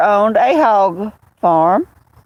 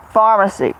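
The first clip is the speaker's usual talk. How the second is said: normal talking voice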